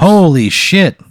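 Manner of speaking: high, loud, drawn-out astonishment; amazed exclamation. Real, not acted. Player learning the gift card is worth a thousand, amazed. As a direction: stretch it out slowly in amazement.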